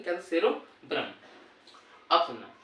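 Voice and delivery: ordinary delivery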